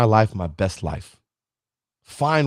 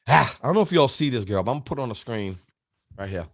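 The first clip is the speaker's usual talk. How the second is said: normal talking voice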